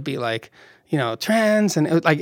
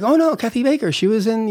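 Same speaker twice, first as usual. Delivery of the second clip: higher voice for the eager homeowner; homeowner woman quoted; 8 semitones higher than usual. Retold or imitated. Higher, lightly voiced homeowner telling the director about Kathy Baker. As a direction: lightly play the woman who owns the house. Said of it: "She's like".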